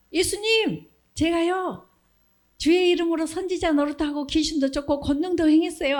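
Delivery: high, whiny pleading voice; a believer whining that he did everything. Retold or imitated. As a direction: plead your case to Jesus. False believer pleading with Jesus.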